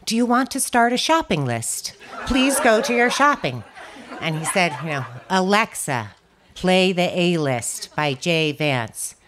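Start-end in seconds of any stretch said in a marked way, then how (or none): 0.1-3.6 higher, perky voice-assistant impression; robotic Alexa voice. Teller voicing Alexa's wrong reply, mock assistant voice. 5.3-9.2 over-articulated, patient command; slow deliberate request. Retold or imitated. Tony repeating his request to Alexa, slow and deliberate.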